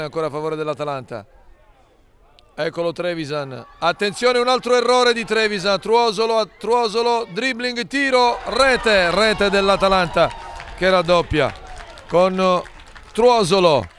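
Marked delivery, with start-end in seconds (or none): 3.8-13.9 breathless italian goal commentary. Real, not acted. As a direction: build up fast to the goal shout. Second goal, rapid build to call. (telecronaca concitata del raddoppio, più acuta)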